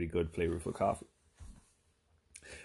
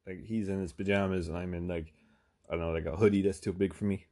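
normal talking voice

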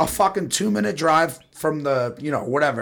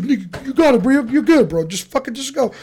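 high, loud hurried calming; calm him down in a squeaky rush; high mock reassurance; 11 semitones higher, 4 dB louder than usual. Friend reassures panicked driver.

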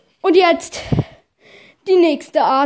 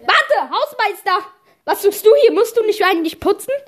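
surprised; 6 semitones higher than usual. Acted. Teacher calls out to janitor.

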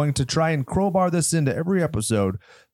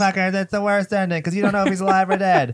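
higher-pitched imitation of angry fans; mock outrage; 6 semitones higher than usual. Host imitates everybody complaining about the ending, mock outrage. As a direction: rant like an exaggerated angry viewer.

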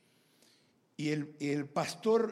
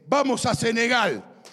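high, loud yell across to someone; acted-out excited holler; imitated shout; 7 semitones higher, 10 dB louder than usual. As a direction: shout it out as a sudden announcement. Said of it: "me grita y me dice".

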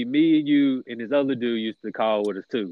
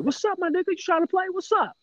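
very high squeaky doll voice; mock toy doll greeting; talking doll voice.

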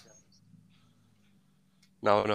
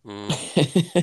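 imitating Lee; 5 semitones higher than usual. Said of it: "He just turned into Lee".

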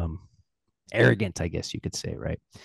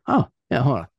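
much higher, breezy and offhand; casual merchant; 6 semitones higher, 5 dB louder than usual. Retold or imitated. Merchant casually agrees to fetch the ring, breezy high voice. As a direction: toss it off like it's no big deal. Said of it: "The guy's like".

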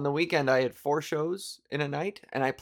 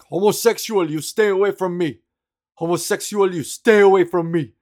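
loud, higher yell of a stranger; angry harasser; 5 semitones higher, 9 dB louder than usual. Retold or imitated. Stranger on the subway yelling at her, loud and angry. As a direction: shout it like a hostile stranger. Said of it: "he was really angry".

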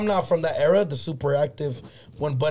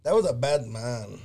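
normal talking voice

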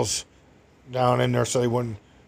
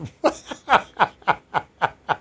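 laughs; 5 semitones higher than usual. Real, not acted. Laughing at punchline.

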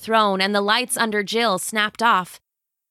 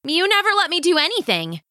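higher, fast resentful outburst; angry teen; 10 semitones higher, faster than usual. Acted. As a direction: throw it at her mother. Jill yells at mother.

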